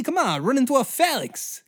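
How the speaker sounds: high villain voice, even taunting; first taunt, measured and sly; old-timey villain taunt. Retold or imitated. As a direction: Alexander coaxing the charge. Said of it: "I love that voice".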